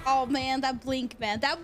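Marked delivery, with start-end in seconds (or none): none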